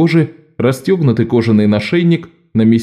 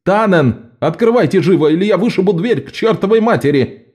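angry shout, higher voice; 8 semitones higher than usual. Acted. Аньеис ломится в дверь.

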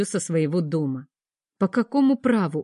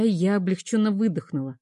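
ordinary delivery, nothing marked

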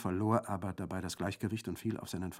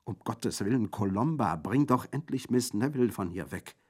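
urgent plea; 4 semitones higher, 7 dB louder than usual. Acted. Orso pleading urgently.

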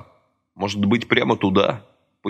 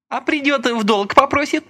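stern, high questioning. A narrator's reading. Masha questions Lika sternly about lending, high stern voice. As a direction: press her sternly. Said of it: "сурово спросила Маша".

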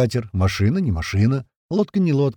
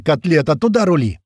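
loud, raised-pitch command; yelling; 5 semitones higher, 5 dB louder than usual. A narrator's reading. Crewman telling the driver where to steer, yelling. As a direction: yell directions at the driver. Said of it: "заорал Упырь".

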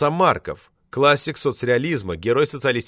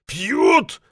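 growled; 6 semitones higher than usual. A narrator's reading. Drunk poet growls back at the administrator. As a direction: snarl the single word back. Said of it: "прорычал Влад".

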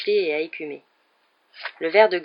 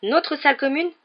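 high-voiced, curt indignation; 5 semitones higher, 4 dB louder than usual. A narrator's reading. A Ravenclaw girl sets the stranger straight, curtly. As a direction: snap back, putting the stranger in place. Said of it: "répondit sèchement la fille".

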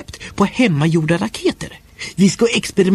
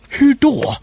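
high, indignant snap; a touch of boyish outrage; a boy answering his mother back in mild anger; angry; 8 semitones higher than usual. A narrator's reading. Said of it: "sa jag argt".

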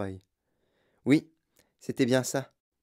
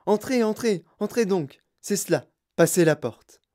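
effusive, excitable host voice; lively eccentric host greeting; Wonka welcoming; 3 semitones higher, 4 dB louder than usual. Acted. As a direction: gush over a young guest and hurry him in.

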